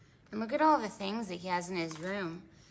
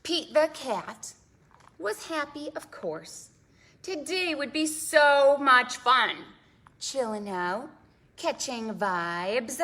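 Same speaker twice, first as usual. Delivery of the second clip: put-on lively read-aloud for small children; storytime reading; 3 semitones higher, 5 dB louder than usual. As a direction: read it like a picture book to kids. Reads picture book to kids.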